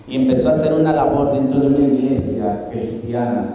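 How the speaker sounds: ordinary delivery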